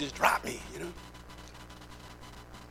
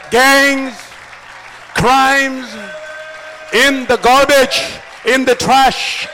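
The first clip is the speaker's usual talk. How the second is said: loud, raised, drawn-out declarations; full-force preaching; 8 semitones higher, 18 dB louder, slower than usual. Real, not acted. Preacher listing what stayed in the trash, full-force preaching. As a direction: shout each item out to the whole crowd.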